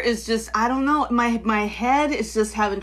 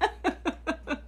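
breathy laugh; 6 semitones higher, 10 dB quieter than usual. Real, not acted.

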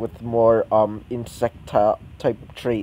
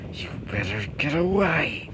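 higher, strained villainess warning; acted threat; 4 semitones higher, 4 dB quieter than usual. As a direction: warn them off in a strained voice. Villainess reacts to new summon.

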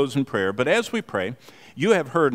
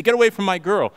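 put-on angry boyfriend shout; 9 semitones higher, 7 dB louder, faster than usual. Bouncer quoting angry boyfriend.

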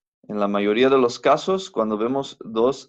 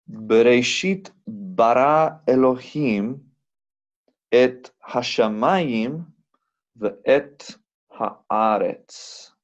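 slow reading with a hold on bara; much slower than usual. Shown as a demonstration. Teacher rereading the verse for students, slowly. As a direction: read the whole verse slowly, stretching the end. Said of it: "vamos a leer esto juntos".

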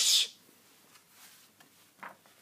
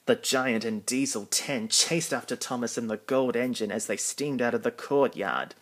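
usual speaking voice

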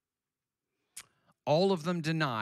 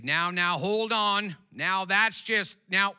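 mock Southern drawl, louder and higher; Southern accent; 10 semitones higher, 10 dB louder than usual. Preacher mocking a Southern objector, Southern accent. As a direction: imitate a Southern church objector. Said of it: "with a Southern accent".

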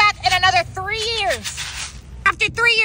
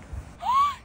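excited gasp; 4 dB quieter than usual. Acted. Spots favorite ice cream.

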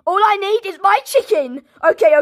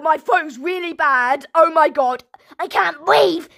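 normal talking voice

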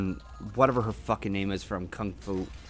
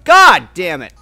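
frustrated yell; 6 semitones higher, 15 dB louder, slower than usual. Real, not acted. Frustrated at getting hit in game.

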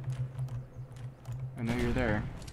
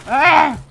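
high loud cry of shock; a short yelp of surprise; startled yell; 15 dB louder than usual. Real, not acted. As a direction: gamer yelp on dying. Killed suddenly.

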